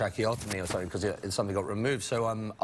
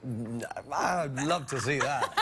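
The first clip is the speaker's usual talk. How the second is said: laughing; 3 semitones higher, much slower than usual. Real, not acted. Imagining the character playing music.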